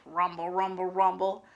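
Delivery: low soft rumble sound; quiet, low stomach rumble; rumbling tummy. A narrator's reading. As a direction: growl it low like a hungry belly. Imitating stomach noise.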